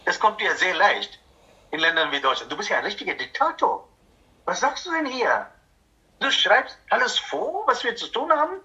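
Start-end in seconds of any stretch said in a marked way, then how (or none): 2.5-8.6 higher, fast whining complaint; mock indignant protest. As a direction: play the affronted church member who won't be told. Acts a member protesting to the leader. (spöttisch empörter Protest, höher)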